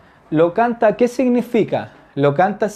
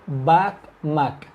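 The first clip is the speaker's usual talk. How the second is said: slow model word; 2 semitones lower than usual. Shown as a demonstration. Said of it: "teacher introduces new verb".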